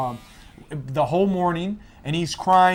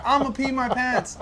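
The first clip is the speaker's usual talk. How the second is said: voiced daughter; 7 semitones higher than usual.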